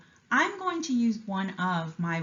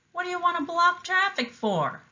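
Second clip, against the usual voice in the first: higher, called-out driver's complaint; gruff limo driver; 11 semitones higher than usual. A narrator's reading. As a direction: shout out of the window at the traffic. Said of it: "he called out".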